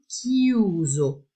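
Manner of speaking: "chiuso" said KEE-oo-zo in Italian; Italian word chiuso. Shown as a demonstration. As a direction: say "chiuso" with a hard k. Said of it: "Chiuso quer dizer fechado". (pronúncia italiana de "chiuso")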